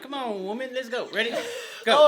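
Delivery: laughing while teasing a friend; giggly, high playful scolding. Real, not acted.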